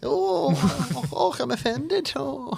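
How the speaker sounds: high, mock-offended posh Scottish accent. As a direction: clutch your pearls in a posh Scottish accent. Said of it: "in a posh Scottish accent".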